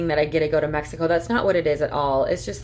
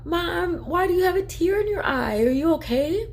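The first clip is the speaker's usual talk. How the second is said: put-on much higher small child's voice; imitating daughter; 12 semitones higher than usual. Mom imitating her daughter asking about her tear, high child voice. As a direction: mimic a worried little girl. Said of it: "And Asha's like".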